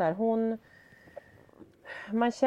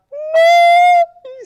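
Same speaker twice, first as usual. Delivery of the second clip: huge, high-pitched scream; imitated child's shrill scream; 15 dB louder than usual.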